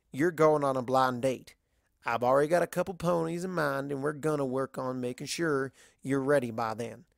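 lightly voiced Southern farm mare, frank and friendly; level with her like a blunt country friend; a hint of a drawling Southern mare, teasing; Applejack voice.

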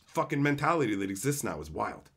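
ordinary talk

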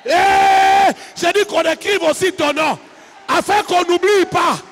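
loud, high sermon climax over music; fiery preaching. Acted. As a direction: preach it full force to the crowd, half singing. Preaching at full force.

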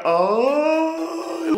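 put-on high little old-lady "ay"; a fussy doña's sigh. Old lady on the bus, high sigh.

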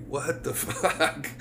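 slow, soft, laughing through the words; baffled by the silence, giggling it out; laughing disbelief. Retold or imitated. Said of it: "I'm like".

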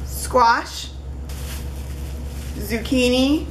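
ordinary delivery

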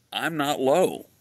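high, loud comic punchline; playful emphatic objection; tongue-in-cheek. Real, not acted.

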